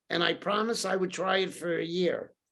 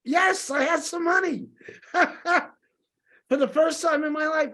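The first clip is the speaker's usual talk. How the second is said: laughing; 7 semitones higher than usual. Real, not acted. Laughing admission of money.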